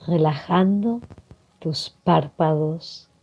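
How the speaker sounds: calm meditation voice with long pauses; guided meditation. Acted. Facilitator guiding listeners to breathe and relax, calm meditation delivery. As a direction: guide them gently into relaxation, leaving space to breathe.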